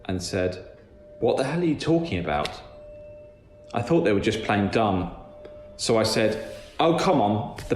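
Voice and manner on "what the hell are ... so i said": ordinary delivery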